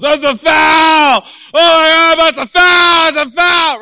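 high, drawn-out full-force shout; imitating an irate fan yelling foul; angry sideline yell. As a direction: holler it long and loud like an outraged sports parent. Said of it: "and he yells".

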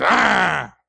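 snarling dog noise; imitated dog growl. Dog answering the driver, mock snarl. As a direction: growl back like a mean dog. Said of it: "that dog just growls at you".

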